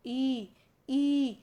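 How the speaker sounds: ई, ई said with lips barely open; spread-lip long ई, repeated; vowel demo. Shown as a demonstration. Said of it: "जैसे आप बोल के देखो".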